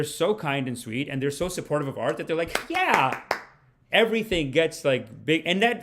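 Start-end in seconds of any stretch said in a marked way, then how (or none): 2.7-3.2 high, mock-enthusiastic yeah; overeager cheer. A sweet supportive crowd that laughs at anything, overeager cheer. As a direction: cheer like an easily pleased crowd.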